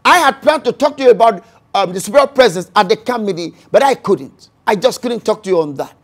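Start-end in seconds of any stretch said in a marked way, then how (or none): none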